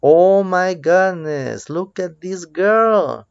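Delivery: high, drawn-out oh-my-goodness dismay; mock shock. Teacher pointing pupils to a screaming girl, mock shocked. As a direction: act appalled for young learners, stretching the words.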